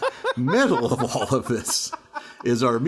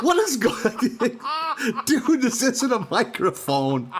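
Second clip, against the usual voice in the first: very high, stretched-out incredulous cry; laughing disbelief, slow and pitched way up; retold baffled outcry; bewildered; 9 semitones higher, slower than usual. Retold bafflement at the karaoke machine.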